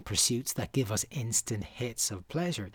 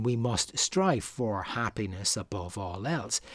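ordinary delivery

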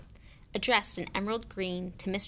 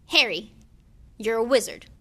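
announcing; 3 semitones higher, 9 dB louder than usual. Acted. Hagrid's big reveal.

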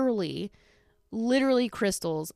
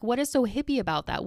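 ordinary talk, nothing marked

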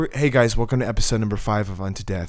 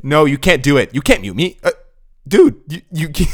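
loud, higher protest; indignant yell; 6 semitones higher, 9 dB louder than usual. Real, not acted. Refusing to be muted by his cohost, loud. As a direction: shout back in protest. Defies threat of muting.

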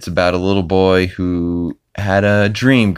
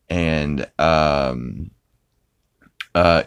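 ordinary talk

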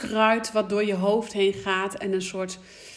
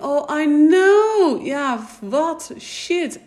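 exasperated groan; 7 semitones higher, 7 dB louder, much slower than usual. Retold or imitated. Frustrated reaction to cliché.

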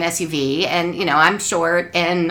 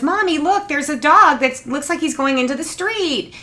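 put-on high, excited little boy; child's voice; 7 semitones higher than usual. Son in the back seat pointing out a dog, high child's voice. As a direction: imitate her young son spotting a dog. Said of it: "our son Kevin goes".

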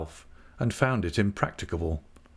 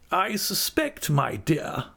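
aunt; 8 semitones higher, slower than usual. A narrator's reading. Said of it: "said my aunt, quietly working".

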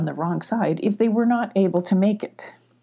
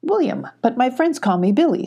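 high cheerful kitten voice; a little cat greeting a friend; 6 semitones higher than usual. A narrator's reading. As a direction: kitten introducing Billy, higher. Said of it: "said the goat".

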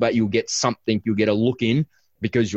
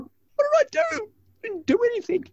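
put-on upset young daughter. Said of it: "She's like".

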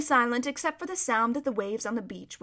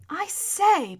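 put-on higher, taken-aback boy; raised-pitch protest; rather shocked; 8 semitones higher, 5 dB louder than usual. A narrator's reading. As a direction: break in with polite shock. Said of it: "in a rather shocked voice".

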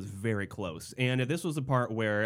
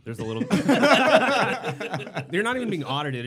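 louder, laughing while talking; 9 semitones higher, 8 dB louder, faster than usual. Real, not acted. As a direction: talk through a laugh. Laughing at joke.

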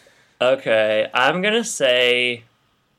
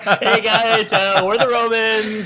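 high, put-on friendly greeting; hammed-up high-voiced Roman introducing himself; invading Romans; 9 semitones higher, slower than usual. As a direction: play a chummy Roman newcomer, pitched up. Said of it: "idea of them bringing haunted houses".

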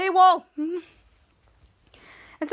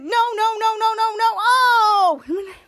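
high, louder frantic string of noes; mock panicked cries; 7 semitones higher, 5 dB louder, much slower than usual. Kids being tortured one by one cry out in high mock panic. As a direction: wail it like a cartoon kid in a panic. Kid voiced panicking in retold scene.

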